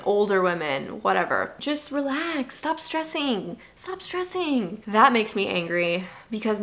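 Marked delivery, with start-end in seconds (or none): 1.6-4.8 higher, softer, slow soothing mock; condescending calm-down voice; patronizing advice. People telling her to relax about conceiving, patronizing. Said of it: "this comes from all people".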